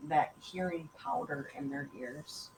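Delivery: usual speaking voice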